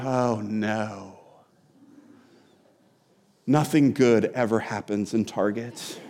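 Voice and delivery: low, soft groan of dread at Target; mock dread. Pastor recalls dreading a Target run, low dread. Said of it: "And I went".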